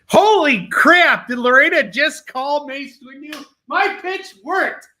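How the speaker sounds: high, loud, drawn-out cheer of triumph; excited shout. Real, not acted. Host cheering as a viewer buys his comic, excited shout. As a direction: whoop like a salesman who just closed.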